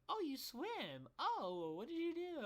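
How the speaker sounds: put-on high, gushing girl voice at a party; impressed girl. Girl at prom asking about swimming, high and gushing.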